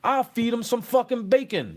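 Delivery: fired up like a volunteer signing up for war; gung-ho bravado. Retold or imitated. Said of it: "I was the one that said".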